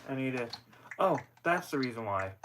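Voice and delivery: ordinary talk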